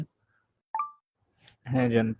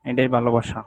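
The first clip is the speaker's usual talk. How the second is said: normal talking voice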